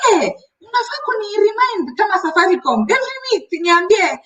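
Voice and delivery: put-on the client's Kenyan accent, pitched high and insistent; retelling the angry customer in his Kenyan accent. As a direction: imitate the customer's Kenyan accent, earnest and demanding. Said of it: "his accent made me so happy".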